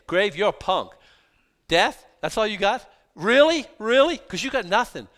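loud, high taunting jeers; mock the grave to its face; trash talk. Preacher voicing Paul jeering at the grave, loud and high. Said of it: "He's saying".

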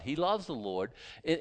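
ordinary talk, nothing marked

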